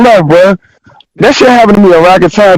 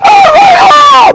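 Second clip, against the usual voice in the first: extremely high cracking cry of shock; hammed-up crying oh; mock wounded wail. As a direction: wail it like you're crushed. Told he's out of the battle.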